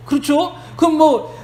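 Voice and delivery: ordinary talk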